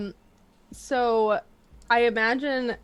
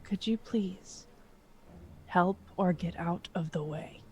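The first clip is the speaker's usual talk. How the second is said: quiet; 10 dB quieter than usual. Acted. Character turning to the squirrel and her friend, quiet. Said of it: "and just quietly goes".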